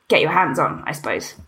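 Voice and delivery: usual speaking voice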